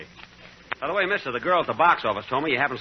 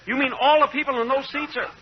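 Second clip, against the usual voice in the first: high-pitched, aghast question; shocked disbelief; 11 semitones higher, 4 dB louder, slower than usual. Acted. Moviegoer learning the theater is a mortuary, shocked. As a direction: recoil in horrified astonishment. Customer learns seats hold corpses.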